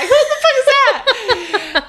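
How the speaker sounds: high, slow, laughing squeal. Retold or imitated. Laughing at herself, squinting without glasses.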